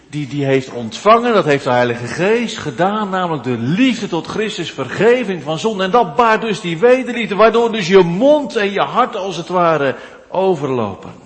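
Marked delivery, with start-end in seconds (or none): 5.8-11.1 swelling pulpit fervour; rising preaching. Acted. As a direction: pitch climbs higher and louder as the preaching swells. Preacher building to climax.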